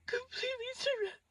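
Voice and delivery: high broken whimpering through tears, hammed-up; mock sobbing. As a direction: sob it out like a terrified wife. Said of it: "I'm crying".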